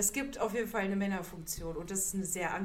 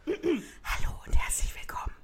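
unvoiced mock podcast greeting; whispered intro; 6 semitones higher, 4 dB louder than usual. Teasing the host with a whispered show opening. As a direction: whisper the welcome like an ASMR host.

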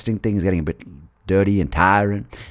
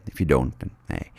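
ordinary talk

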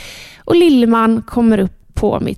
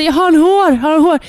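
much higher, louder desperate repeated shout; frantic shouting; 6 semitones higher, 3 dB louder than usual. Retold or imitated. Mother in labour yelling at midwives, desperate high shout. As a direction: yell it twice, pleading for an answer. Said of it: "jag skriker verkligen".

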